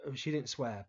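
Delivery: ordinary delivery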